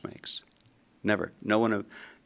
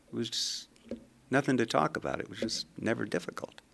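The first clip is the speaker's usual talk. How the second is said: softer, almost whispered, shrugging it off; soft modest reply; 9 dB quieter than usual. Retold or imitated. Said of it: "And he said".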